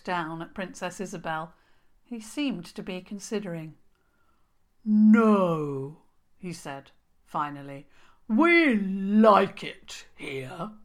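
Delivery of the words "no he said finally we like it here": troll king. A narrator's reading.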